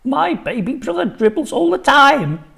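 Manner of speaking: high, loud cheeky shout. A narrator's reading. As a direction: call it out in class. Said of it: "I'd shouted out".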